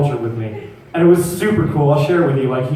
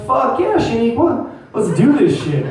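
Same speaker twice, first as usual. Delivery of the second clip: high-pitched gung-ho reply; mock eager excitement; 9 semitones higher than usual. Boyfriend agreeing to girlfriend's role-play idea, mock eager excitement. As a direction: jump at the offer, voice shooting up.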